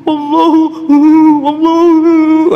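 high, loud, drawn-out sobbing Allahu; mock sobbing imam. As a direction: wail Allahu like an imam crying mid-recitation. Imam sobbing while reciting. (imam menangis tersedu-sedu, suara tinggi dan keras)

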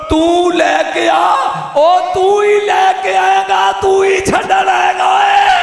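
high, slow wailing shout; impassioned preaching cry. Acted. Preacher crying out a Sayyid's words, slow high wail. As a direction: cry it out slowly like a grieving elder.

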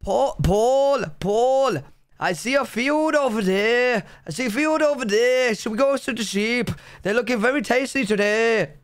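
hammed-up sing-song Welsh accent. Shown as a demonstration. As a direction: call out with a Welsh lilt.

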